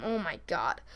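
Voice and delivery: normal talking voice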